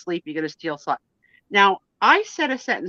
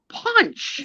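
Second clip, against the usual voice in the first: "punch." much higher, insisting; insistent punch; 12 semitones higher than usual. Retold or imitated. Wife urging husband to just punch, insistent. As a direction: push the word "punch." at him. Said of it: "I was like".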